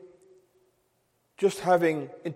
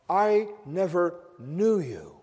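slow, solemn, softer verdict; voice of Christ; 3 dB quieter, slower than usual. Retold or imitated. Preacher voicing Christ rejecting the false believers, slow and solemn. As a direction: pronounce it like a judge turning them away. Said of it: "And Christ will say to them".

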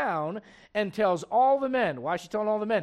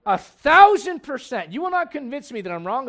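emphatic; 6 semitones higher, 10 dB louder than usual. Real, not acted. Emphatic claim to church.

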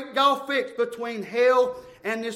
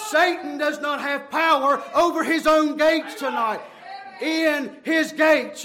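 climactic preaching; 3 semitones higher, 4 dB louder than usual. Real, not acted. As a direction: proclaim it at full force. Preaches at full force.

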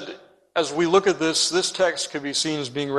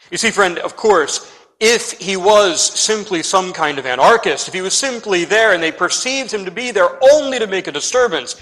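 louder, building preaching voice; 3 semitones higher, 6 dB louder than usual. Acted. Preacher urging the congregation with rising force. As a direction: press the point home to the congregation.

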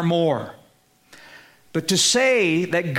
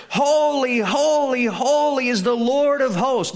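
raised higher, lifted and proclaiming; ringing declaration; 7 semitones higher than usual. Shown as a demonstration. Preacher to congregation, echoing the seraphim's cry, higher and proclaiming. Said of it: "They're declaring one to the other".

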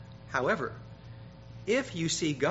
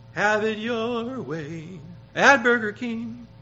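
slow, higher, loud ad voice; Burger King jingle; 8 semitones higher, 9 dB louder, slower than usual. Retold or imitated. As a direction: sell it like an old TV ad. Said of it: "the old Burger King advertisement".

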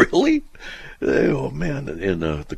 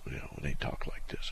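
whispered aside; 6 semitones lower, 12 dB quieter than usual. Real, not acted. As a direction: whisper it under the breath, much softer. Aside about the letter writers.

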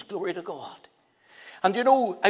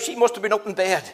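normal talking voice

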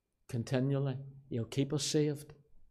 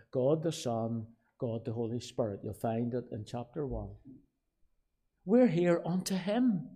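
ordinary talk

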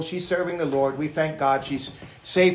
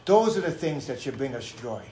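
ordinary talk

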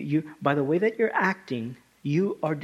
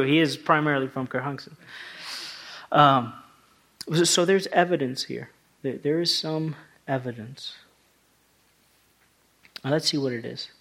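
ordinary talk, nothing marked

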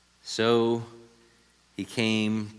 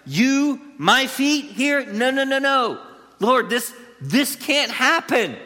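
acting out Peter, much higher, pleading no no no; emphatic protest; 7 semitones higher than usual. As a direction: Peter recoiling in disbelief, voiced high. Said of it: "it's emphatic".

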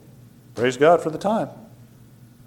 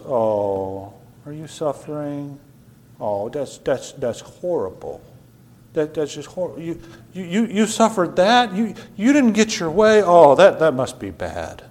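mock sympathy. The Lord fussing over a preacher's small troubles, mock-pitying.

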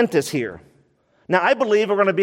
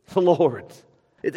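usual speaking voice

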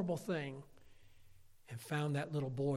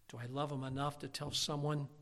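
usual speaking voice

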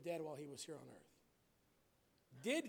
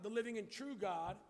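normal talking voice

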